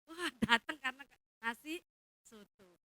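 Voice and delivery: mocking exclamation. Real, not acted. Mocking people who come for food.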